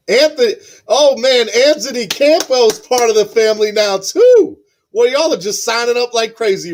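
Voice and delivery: high, loud, thrilled outburst; excited announcement. Real, not acted. Host announcing Anthony Campos joined the family, thrilled. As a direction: burst out with the big news, voice leaping up. Host reacts to another new member.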